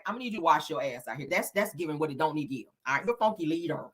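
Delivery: ordinary talk, nothing marked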